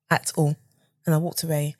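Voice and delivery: ordinary talk, nothing marked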